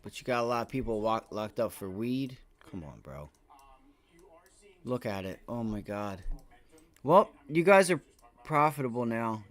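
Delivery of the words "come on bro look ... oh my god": ordinary talk, nothing marked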